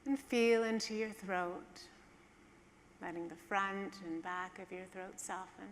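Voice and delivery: calm, hushed guide easing listeners down; guided meditation. Acted.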